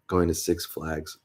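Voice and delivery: normal talking voice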